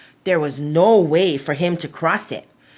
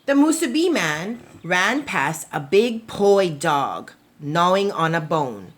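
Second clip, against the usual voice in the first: usual speaking voice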